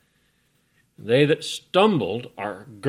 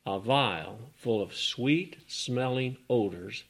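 ordinary talk

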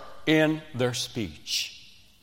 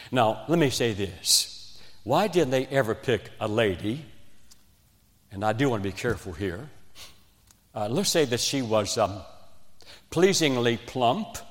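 normal talking voice

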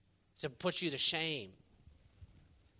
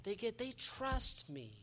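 smug mock pride; 7 semitones higher, much slower than usual. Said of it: "You're like".

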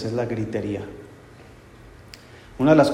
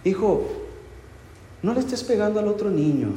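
higher, softer mock coaxing parent with a laugh; a hint of a sweet indulgent mother; mock gentle parent; 8 semitones higher, 4 dB quieter than usual. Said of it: "hablarles bien, suavecito". (imitando a una madre dulce que regaña suave, un poco más agudo)

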